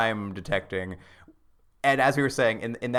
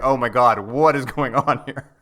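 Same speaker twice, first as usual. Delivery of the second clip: mock shock; 7 dB louder than usual. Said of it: "I was just like".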